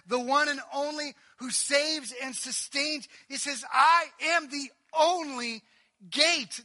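loud, high, shouted litany; full-force preaching. Acted. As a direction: hammer each no to the congregation. Long preaching climax.

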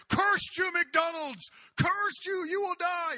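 shrieking high, loud mock curse; hammed-up outrage, thrown way up high; mock furious curse. Preacher playing a customer cursing McDonald's, furious shout.

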